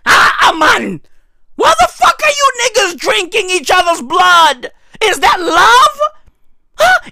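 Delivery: high, loud exasperated yell; outraged shout. Real, not acted. Comedian yells at blood-drinking couples, outraged. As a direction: throw the question out in disbelief.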